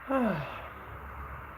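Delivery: long, high, airy yawn that falls away; real yawn mid-stream; yawning sigh. Real, not acted. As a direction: let a sleepy yawn out. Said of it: "I'm yawning".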